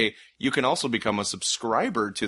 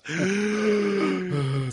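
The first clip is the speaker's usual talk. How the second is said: gasp for air; 8 semitones higher than usual. Real, not acted. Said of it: "That was hard to do in one breath".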